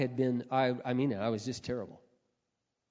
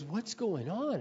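high-pitched puzzled question; lightly acted bewilderment; mildly mimicked bewildered mother; 7 semitones higher, slower than usual. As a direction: imitate a baffled mom, pitch swinging up. Bewildered mother questions her son.

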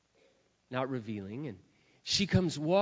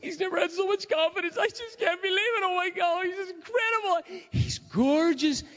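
much higher, teary, gushing voice; tearful gushing friend; 12 semitones higher than usual. Retold or imitated. Childhood friend at the reunion, gushing tearfully. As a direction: gush through happy tears. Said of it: "she's just sobbing".

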